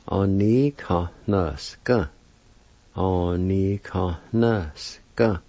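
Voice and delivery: slow, drawn-out syllables for learners; slow pronunciation demo. Shown as a demonstration. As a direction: model the word slowly, syllable by syllable. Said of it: "I'll say the words one more time".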